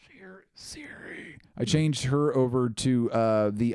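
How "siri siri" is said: hushed, drawn-out whisper. Real, not acted. Host summoning the assistant on a watch, whispered. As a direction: call softly in a slow whisper.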